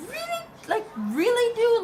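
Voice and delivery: high, loud, incredulous really; mock outrage at a useless bystander; disbelief. Scolding the UPS guy in disbelief, loud and high. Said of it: "And I'm like".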